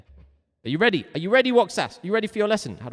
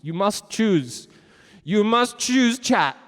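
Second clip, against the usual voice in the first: loud, slow, stern urging; hammed-up, forceful ultimatum; dramatic command; 5 semitones higher, 6 dB louder, slower than usual. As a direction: press them hard to choose. Pressing chat to choose.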